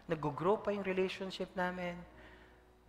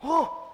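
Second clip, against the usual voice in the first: 1 angry gasp; 13 semitones higher, 12 dB louder than usual. Retold or imitated. Wife's angry outburst retold.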